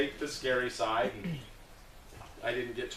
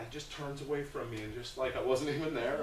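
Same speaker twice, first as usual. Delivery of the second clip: ordinary talk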